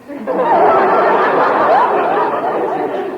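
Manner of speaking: hearty laugh at the joke; big laugh. Acted. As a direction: belly laugh. Father laughs at son's joke.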